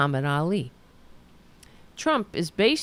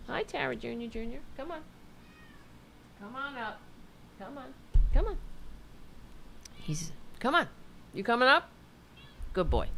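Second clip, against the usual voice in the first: soft, higher coaxing talk to the dog; sweet-talking the dog up onto the couch; 5 semitones higher, 8 dB quieter, faster than usual. Real, not acted. Owner coaxing her dog up, soft and higher.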